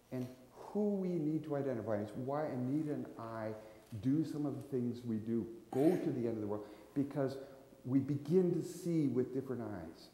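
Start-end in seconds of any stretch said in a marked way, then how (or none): none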